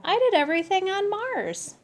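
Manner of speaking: put-on high, bright-eyed kid cat voice; high boasting kitten voice; proud kitty boy. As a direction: pitch way up and boast like a little cat hero. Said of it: "replied Skippy John".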